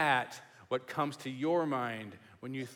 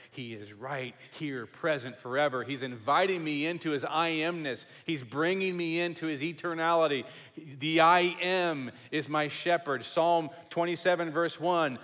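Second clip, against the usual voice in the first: ordinary talk, nothing marked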